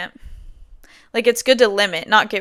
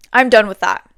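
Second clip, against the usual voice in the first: ordinary talk, nothing marked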